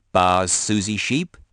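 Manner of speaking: ordinary delivery, nothing marked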